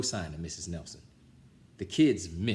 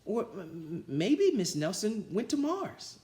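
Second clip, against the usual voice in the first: put-on stammering kid; 11 semitones higher than usual. A narrator's reading. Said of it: "said another kid".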